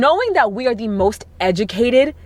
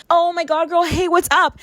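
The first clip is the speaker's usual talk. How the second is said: higher, bright fake-friend hello; fake friendly greeting; 8 semitones higher than usual. Woman rescuing a stranger from a guy, higher friendly greeting. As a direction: greet a stranger as if you know her. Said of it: "be like".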